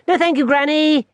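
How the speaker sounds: put-on a young boy explaining; small boy. Barney tells his grandmother about his fall, high little-boy voice. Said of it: "he said".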